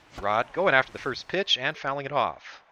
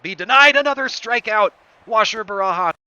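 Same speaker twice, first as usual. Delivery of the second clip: loud, high triumphant strikeout call; excited strikeout call; 8 semitones higher, 9 dB louder than usual. Real, not acted. Commentator celebrating his pitcher's strikeout, excited. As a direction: belt out the strikeout.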